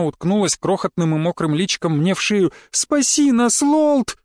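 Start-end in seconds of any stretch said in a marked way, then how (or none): 2.7-4.2 put-on high pleading child voice; crying little girl. A narrator's reading. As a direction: beg like a tearful small girl. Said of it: "Из глаз её текли слёзы".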